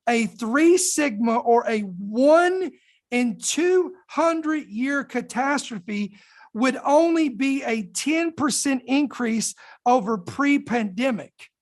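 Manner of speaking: slow, deliberate reading of the quote; slow emphatic read-through; read really slow. Shown as a demonstration. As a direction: let every number soak in slowly. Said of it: "I wanna read this really slow".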